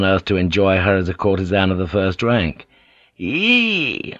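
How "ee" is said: a high, strained little "Ee"; stunned fisherman, barely getting it out; mumbled "Ee". A narrator's reading. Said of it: "Wo mumbled".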